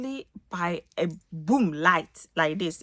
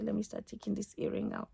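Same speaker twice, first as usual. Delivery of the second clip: ordinary talk, nothing marked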